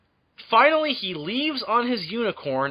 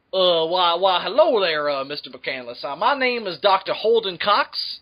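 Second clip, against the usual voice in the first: hammy Southern doctor, drawn out; southern accent; 3 dB louder, slower than usual. Acted. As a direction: introduce yourself like a folksy Southern doctor. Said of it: "I'm gonna put on my southern accent".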